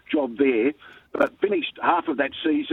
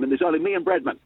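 usual speaking voice

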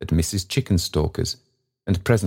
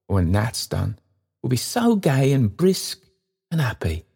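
gentle daughter, pitched up; a fond young woman fussing; Meg voiced higher; 6 semitones higher than usual. Meg fussing over the cold child's feet, tenderly.

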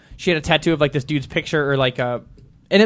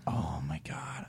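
stunned murmur; 12 semitones lower, 20 dB quieter, much slower than usual. Retold or imitated. Stunned reaction to memorial tattoo.

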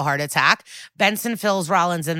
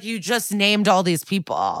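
normal talking voice

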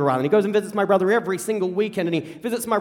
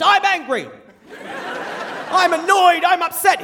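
screaming feelings; 10 semitones higher than usual. Retold or imitated. Dad yelling his feelings across the farm. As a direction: shout each feeling out. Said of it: "hear this scream of just".

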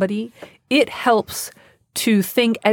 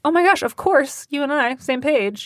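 high-pitched, demonstrated easy agreement; breezy agreement; 7 semitones higher than usual. Shown as a demonstration. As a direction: act as if you both already agree. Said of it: "You just be like".

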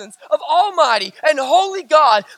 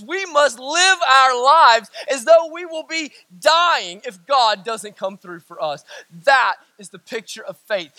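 usual speaking voice

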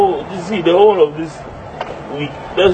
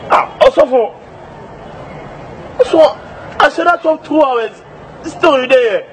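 mock wailing; 8 semitones higher than usual. [name] wails about soap.

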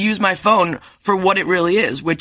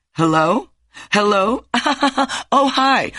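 fake robocall hello; 5 semitones higher, slower than usual. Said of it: "that fake, like".

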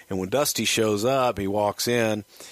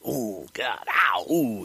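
mock pain; 3 semitones higher, much slower than usual. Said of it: "like he's getting punched in the face".

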